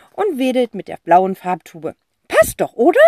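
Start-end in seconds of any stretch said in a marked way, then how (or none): 2.3-3.0 eccentric aunt voice. Acted. Tante Rotz figure voice.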